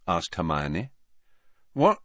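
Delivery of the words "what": high, loud snap back to attention; startled recovery. A narrator's reading. Harry caught drifting by his friend, startled and hastily recovering. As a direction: pull yourself together in a hurry. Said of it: "pulling himself together".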